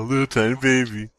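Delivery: ordinary talk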